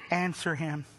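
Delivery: ordinary talk, nothing marked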